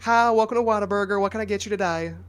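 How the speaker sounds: sweet drive-through greeting; friendly cashier voice. Retold or imitated. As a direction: chirp it like a fast-food cashier. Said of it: "they're like".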